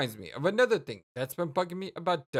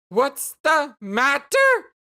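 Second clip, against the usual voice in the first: slow, high, loud repeat; incredulous shout; 8 semitones higher, 8 dB louder, much slower than usual. Acted. Jane throwing Jake's question back, furious shouting. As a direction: explode on the echoed question. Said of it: "makes it seem like you're shouting".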